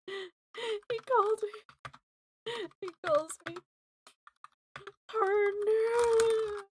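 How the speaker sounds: giddy squeal. Real, not acted. Gushes over being called partner.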